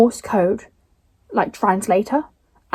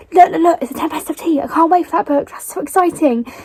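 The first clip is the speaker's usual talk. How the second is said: retold excitement; 6 semitones higher than usual. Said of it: "and I was like".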